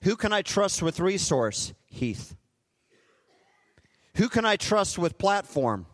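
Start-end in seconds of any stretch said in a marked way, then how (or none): 1.8-2.3 soft answer. Acted. God answers softly by name.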